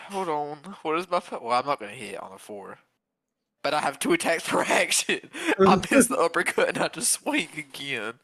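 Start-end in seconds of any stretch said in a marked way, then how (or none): none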